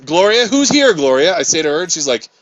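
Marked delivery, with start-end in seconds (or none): 0.1-1.4 loud, high coaxing question; coaxing call. Retold or imitated. To Gloria, a loud high coaxing call. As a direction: call her name to make her answer.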